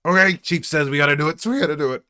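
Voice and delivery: high, loud eager rookie; a rookie obeying the chief, high and loud. Acted. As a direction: push on cheerfully, high. Player in character voice.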